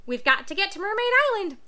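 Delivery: high, loud squawky parrot. Acted. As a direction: chirp it out like an anxious parrot.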